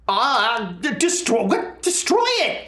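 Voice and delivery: stammering, rising to a high shriek; panicked character cry. Acted. Game master voices Maravic seeing flesh tendrils, frantic high cry. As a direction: build from startled stutter to frantic command. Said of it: "Maravic's like".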